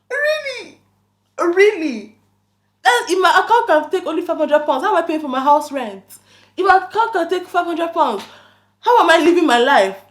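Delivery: high, loud, disbelieving Really? Really?; incredulous outrage. Retold or imitated. Retelling her outraged reply to scammer.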